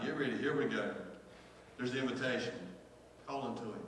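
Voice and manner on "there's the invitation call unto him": ordinary talk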